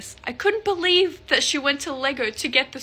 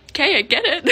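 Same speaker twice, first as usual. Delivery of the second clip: higher, louder, laughing through it; 4 semitones higher, 6 dB louder, much faster than usual. Retold or imitated. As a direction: laugh out the girl's line. Girl's amused reply, laugh heard.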